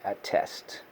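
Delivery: attest whispered softly; whispered word. Shown as a demonstration. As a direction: breathe out attest. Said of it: "a compound word".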